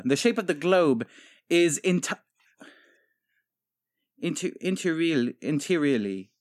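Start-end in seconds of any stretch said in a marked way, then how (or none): 4.2-6.3 "interiorly" sounded out haltingly for entirely; mispronounced entirely. Acted. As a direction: misread entirely as "interiorly", piece by piece. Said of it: "Do you mean entirely?".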